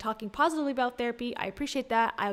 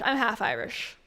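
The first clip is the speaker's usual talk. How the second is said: normal talking voice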